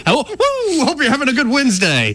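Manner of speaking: high, fast wrestler whoop; Ric Flair impression. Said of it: "Ric Flair putting over".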